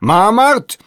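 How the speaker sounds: high, loud query; hard-of-hearing shout. A narrator's reading. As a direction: ask it loudly, straining to hear. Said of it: "שאל בטהובן בקול רם".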